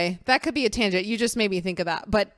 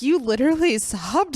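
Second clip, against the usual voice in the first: retold incredulous question, higher; surprised reaction; 5 semitones higher than usual. Host retells her reaction to co-host's sobbing claim, surprised. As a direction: lightly act out your own surprise.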